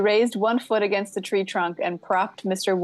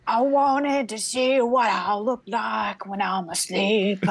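lightly squeaky puppet voice, slow; a hint of a squeaky dummy. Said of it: "a high-pitched, squeaky voice".

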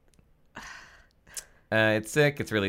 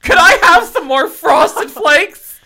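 put-on much higher, louder and slow little-boy voice; Randy's voice; 11 dB louder, slower than usual. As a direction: whine it like a kid asking for cereal. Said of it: "I got to say a Randy line".

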